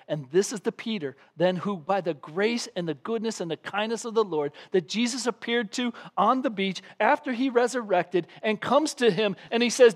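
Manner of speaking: usual speaking voice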